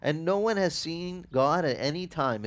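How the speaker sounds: ordinary delivery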